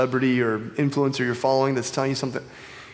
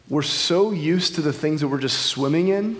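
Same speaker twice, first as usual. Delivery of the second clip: normal talking voice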